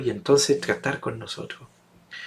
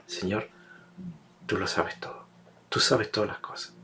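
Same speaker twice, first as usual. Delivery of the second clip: softer, lower, humbled answer; humbled reply; 5 semitones lower, 9 dB quieter than usual. Acted. Peter answers Jesus the third time, soft and humbled. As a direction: answer quietly, hurt by the question.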